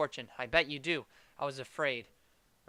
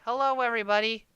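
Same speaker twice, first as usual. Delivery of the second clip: loud greeting; 7 semitones higher, 8 dB louder than usual. Acted. Greeting the whole living room.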